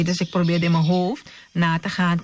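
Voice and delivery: normal talking voice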